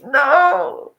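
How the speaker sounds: high, loud, half-crying no; mock panicked no. To the tattoo artist, scared of the needle, mock-crying no. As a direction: yelp it like the needle scares you.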